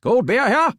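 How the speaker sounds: high, loud ballpark beer call; stadium vendor hawking. Shown as a demonstration. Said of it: "Let's hear what you got".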